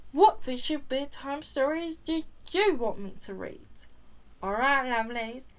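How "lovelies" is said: usual speaking voice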